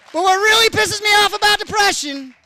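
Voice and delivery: loud, high-pitched rant; performed irritation, pushed loud and high; annoyed outburst. Acted. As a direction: blow up in exasperation. Comic rant about a bad houseguest.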